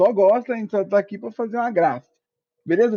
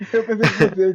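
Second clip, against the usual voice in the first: laughing; 4 semitones higher, 4 dB louder than usual. Real, not acted. Laughing at the answer.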